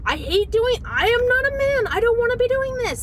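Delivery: very high, loud complaint; high-pitched outburst of disgust and frustration; frustrated whining protest. Retold or imitated. Wife protesting to husband about her role, high frustrated wail.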